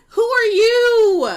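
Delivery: loud, high, drawn-out scoff; scornful challenge. Acted. Dismissing haters who can't hurt her, loud scornful disbelief. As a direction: stretch it out high in mock disbelief.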